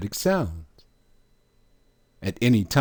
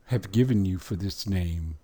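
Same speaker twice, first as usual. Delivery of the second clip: normal talking voice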